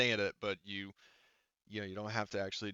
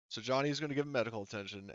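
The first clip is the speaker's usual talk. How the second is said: ordinary talk, nothing marked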